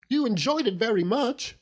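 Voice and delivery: hurt little wife scolding her husband; lightly voiced young wife. A narrator's reading. Wife chiding her husband over the fish, lightly voiced.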